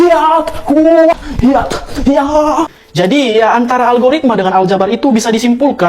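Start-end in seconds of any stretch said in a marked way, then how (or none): 0.0-2.7 mock kung fu cries.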